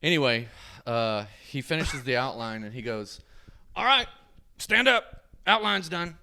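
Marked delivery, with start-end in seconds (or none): 3.7-6.2 loud, high command imitating the tattooer; barked order. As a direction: call out short orders loudly.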